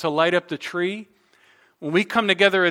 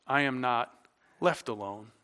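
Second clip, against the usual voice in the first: quiet, intimate; 5 semitones lower, 8 dB quieter than usual. Real, not acted. Softened point.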